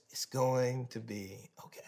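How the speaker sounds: soft, low, soothing murmur; tender reassurance. Retold or imitated. Wife pulling her crying son close, tenderly reassuring. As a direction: comfort gently, dropping pitch and volume.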